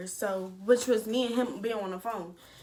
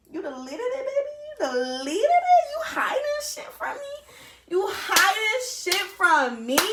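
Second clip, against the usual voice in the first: high, loud, squealing accusation, laughing through it; mock outraged girlfriend; 10 semitones higher, 5 dB louder than usual. Girlfriend catching her man hiding a call, shrill mock outrage. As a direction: shriek it at him half-laughing, half-hurt.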